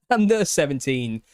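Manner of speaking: usual speaking voice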